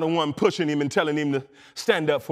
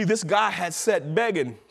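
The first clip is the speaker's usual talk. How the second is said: ordinary talk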